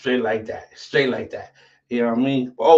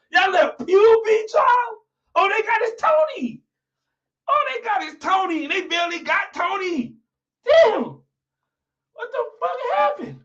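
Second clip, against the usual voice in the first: high wailing stretched-out yell; hammed-up disbelieving shout; mock outrage. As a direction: wail it like you can't believe it. Mock-outraged roast of rival team.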